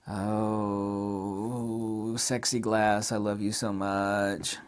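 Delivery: soft, slow lovestruck coo; mock tender cooing.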